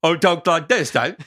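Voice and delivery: put-on high, loud Australian accent; Aussie accent. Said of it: "Like your stereotypical Aussie accent".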